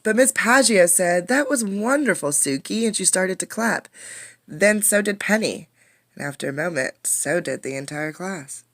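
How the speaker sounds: put-on lively read-aloud for young kids; story reading. A narrator's reading. A teacher reading a storybook to her class, lively. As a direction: bring the picture book to life.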